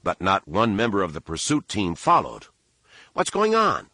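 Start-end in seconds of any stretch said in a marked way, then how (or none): 3.1-3.9 sudden high alarmed bark. A narrator's reading. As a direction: bark the question at him. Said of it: "he barked".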